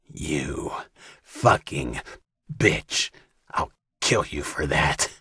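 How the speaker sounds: low snarling rage; low furious threat. A narrator's reading. Wounded captor threatening Katie, low snarl.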